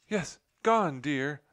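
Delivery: high, loud exasperated cry. A narrator's reading. Nutmeg telling her drowsy husband, crying out high. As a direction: insist it, frantic. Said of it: "Nutmeg cried".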